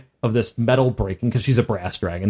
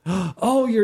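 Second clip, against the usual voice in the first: happy gasp; 10 semitones higher than usual. Acted. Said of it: "she's very happy".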